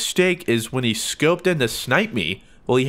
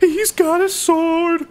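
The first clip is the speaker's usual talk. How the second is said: high, drawn-out squeal of alarm; panicked wail; 14 semitones higher, 3 dB louder, much slower than usual. Real, not acted. Gamer fleeing a sword, drawn-out panicked wail.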